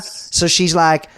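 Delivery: normal talking voice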